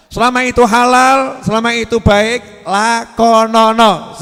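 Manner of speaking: very slow, loud catchphrase; drawn-out call. Acted. Preacher sealing the point to the crowd, slow and loud. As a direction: pull each syllable long and loud.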